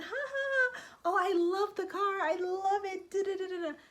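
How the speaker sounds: high, giggling gush over the car; giggly and smiley. Pretend. Excited buyer gushing at the dealership, giggling high. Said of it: "Don't be all smiley and giggly".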